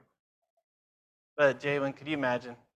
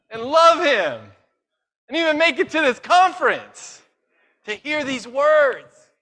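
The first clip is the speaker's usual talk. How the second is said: higher and louder, rising to a climax; full-force preaching; 8 semitones higher, 6 dB louder than usual. Acted. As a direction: preach it with mounting fervor.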